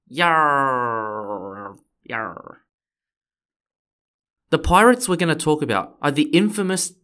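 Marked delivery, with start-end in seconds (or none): 0.1-2.6 long pirate yarr, falling away. Pretend. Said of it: "it's gonna be about pirates".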